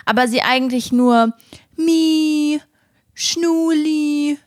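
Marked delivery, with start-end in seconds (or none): 1.8-4.4 high, drawn-out cooing; sing-song dog nickname, stretched out; pet-name call. Shown as a demonstration. Demonstrates pet names for dog.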